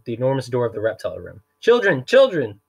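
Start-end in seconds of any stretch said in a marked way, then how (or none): 1.6-2.6 high, loud repeated calling; flustered banker calling the children. Acted. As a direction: shout for the children through the door. Poe calling out at door.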